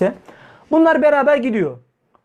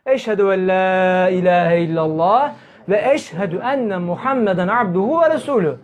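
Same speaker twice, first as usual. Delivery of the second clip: last-words shahada; 3 semitones lower than usual. Acted. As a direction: recite the creed on a long held low note. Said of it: "onların son sözü neydi".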